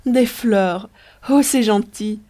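woman thrilled to find flowers in the room; delighted exclamation. Acted. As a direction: gasp out the surprise with joy. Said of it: "s'écria Riquette en pénétrant dans la chambre".

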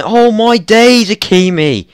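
sudden high, loud outburst of disbelief; real frustrated yell; shout. Real, not acted. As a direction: yell it at the screen, slow on days. Gamer yelling at a goalkeeper blunder.

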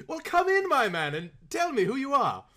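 high genial aristocrat; kindly Earl. Acted. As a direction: welcome him in like a lord. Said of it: "kindly Earl".

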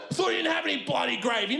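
high, fast indignant shout; accusing dad. Retold or imitated. Dad to KFC girl, high indignant accusation. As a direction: call her out on the gravy. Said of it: "dad accuses".

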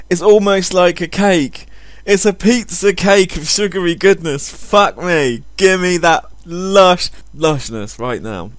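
normal talking voice

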